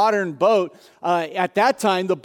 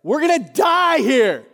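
loud, high panicked plea; frantic disciples; 8 semitones higher, 5 dB louder than usual. Acted. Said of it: "He is shaken awake".

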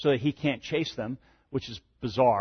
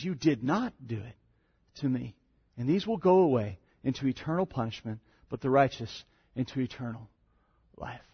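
soft, grave verdict; grave scripture reading; 4 dB quieter than usual. Acted. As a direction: let the sentence fall quietly. Reads the king's final verdict.

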